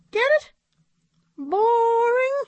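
very high, with pauses between words; squeaky monkey prodding for a laugh; monkey puppet. A narrator's reading. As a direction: spell out the joke in a squeaky puppet voice. Puppet monkey repeats punchline.

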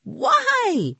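very high-pitched yelp of a question; bewildered cry. A narrator's reading. Rima cries out to Jamie, bewildered cry. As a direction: cry it out, voice leaping up. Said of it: "cried Rima".

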